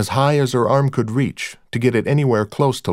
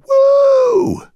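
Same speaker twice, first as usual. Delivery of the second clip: very high, loud "woo"; shouted whoop; 6 dB louder than usual. A narrator's reading. Someone in the club as lights change, high shouted whoop. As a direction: let out a high party whoop. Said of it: "Somebody shouted".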